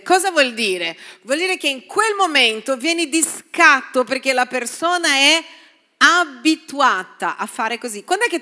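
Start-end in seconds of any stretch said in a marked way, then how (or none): none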